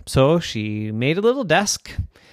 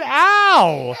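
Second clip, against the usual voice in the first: high, loud indignant ow; hurt brother; 13 semitones higher, 4 dB louder than usual. Acted. Little brother complains at sister throwing toys. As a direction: complain at being pelted.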